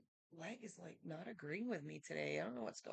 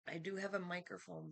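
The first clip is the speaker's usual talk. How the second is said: usual speaking voice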